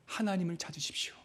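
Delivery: near-whispered, earnest plea; hushed appeal. Real, not acted. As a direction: drop right down and urge them softly. Preacher drops to a hushed appeal.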